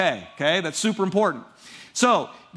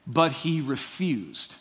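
ordinary delivery

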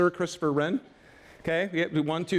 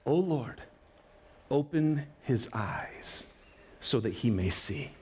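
lower, slow, soft prayer; hushed prayer; 9 semitones lower, 4 dB quieter, slower than usual. Retold or imitated. Elisha praying calmly for his servant, soft and slow. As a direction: pray it gently, in calm trust.

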